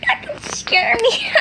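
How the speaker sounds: mock him while laughing; laughing taunt.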